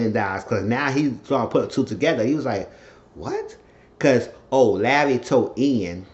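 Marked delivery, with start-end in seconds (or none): 3.1-3.6 tiny high-pitched squeak of surprise; mock disbelief. Man putting two and two together, mock disbelief. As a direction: squeak it softly, rising. Acted stunned reaction in recap.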